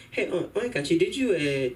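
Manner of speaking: mother checking on a chore; mom's voice. Retold or imitated. Son's mom asking if the chore was done, lightly voiced.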